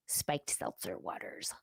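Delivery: hushed, voiceless whisper, playing it sneaky. To viewers about spiked drinks, a sly whisper. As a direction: breathe it like a naughty secret.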